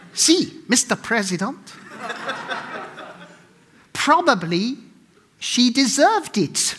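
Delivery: high-pitched, badly faked Bolivian accent. Ambassador answering the president's confession, mock Bolivian accent. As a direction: put on a hammed-up Bolivian accent, ending slowly. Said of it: "I can't do a Bolivian accent".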